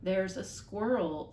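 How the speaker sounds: slow, deliberate, pointing at each word; mother speaking slowly to the father. Acted. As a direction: spell it out word by word. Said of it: "speaking more slowly now".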